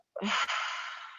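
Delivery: long, audible sighing exhale; letting breath out. Shown as a demonstration. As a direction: let the whole breath go in a sigh. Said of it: "and let it out".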